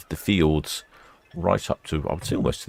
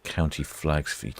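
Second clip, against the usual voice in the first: ordinary delivery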